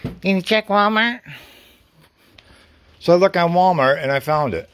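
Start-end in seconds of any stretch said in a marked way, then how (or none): 0.0-1.2 a hint of the wife's higher voice; quoting wife. Husband quoting wife's helpful suggestion, lightly voiced higher. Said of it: "my wife says".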